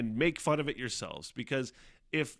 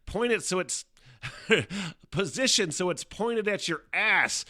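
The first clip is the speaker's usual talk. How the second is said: mock bidet makers; 8 semitones higher, 5 dB louder than usual. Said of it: "these guys are like".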